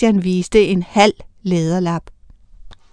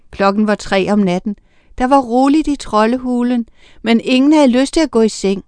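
ordinary delivery, nothing marked

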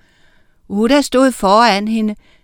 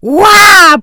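loud, high drawn-out roar; bellowed "waah"; 11 semitones higher, 12 dB louder than usual. A narrator's reading. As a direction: roar like a fire-breathing dragon. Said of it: "brølede Oscar".

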